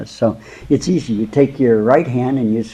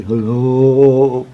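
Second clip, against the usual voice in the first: booming voice of God, a bit louder; God's voice; 7 dB louder than usual. Retold or imitated. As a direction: speak as God, calmly grand. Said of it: "and God says".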